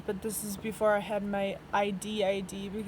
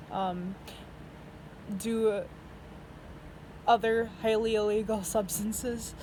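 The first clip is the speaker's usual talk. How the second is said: ordinary talk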